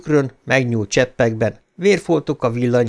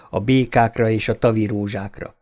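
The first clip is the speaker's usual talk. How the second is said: ordinary delivery, nothing marked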